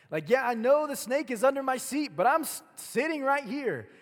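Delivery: high, loud protest; stubborn refusal. Acted. Preacher acting people who won't act, loud stubborn refusal. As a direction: dig in and holler the excuse. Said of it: "they're like".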